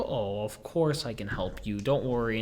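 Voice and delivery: low, soft, soothing reply; reassuring. Retold or imitated. Store worker reassures an old lady, soft and low. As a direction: reassure her gently, dropping low and soft.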